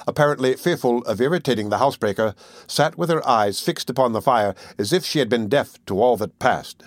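ordinary delivery

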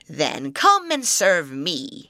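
slow, loud troll voice. Acted. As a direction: growl it out like an old troll.

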